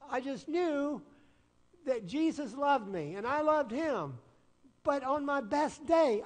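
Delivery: loud, high, rising preacher's climax; preaching at full force. Acted. Preacher tells congregation of his donut guilt, loud and rising. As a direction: build the confession up like a pulpit peak.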